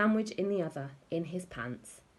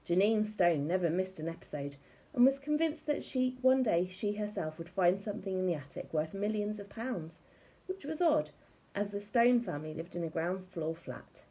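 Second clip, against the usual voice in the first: ordinary delivery, nothing marked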